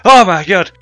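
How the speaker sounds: high, loud jump-scare yelp; startled shriek. Retold or imitated. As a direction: scream like something just jumped you. Retold jump scare reaction.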